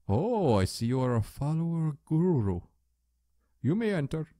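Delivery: a light put-on Indian accent for the guru. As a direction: guru welcoming the player, in a mild Indian accent. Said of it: "I do my stereotypical Indian voice".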